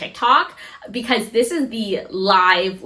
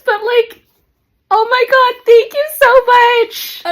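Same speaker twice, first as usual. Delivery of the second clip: tearful thanks; 13 semitones higher, 6 dB louder, slower than usual. Real, not acted. Tearful gratitude for the bag.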